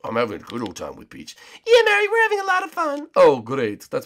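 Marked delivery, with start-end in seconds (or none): 0.0-1.4 a lower, gloating villain brag; Bowser voice. Acted. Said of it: "Bowser, Peach, how are you guys doing?". 1.7-3.1 a much higher, sweet princess falsetto; Peach voice. Acted. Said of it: "Bowser, Peach".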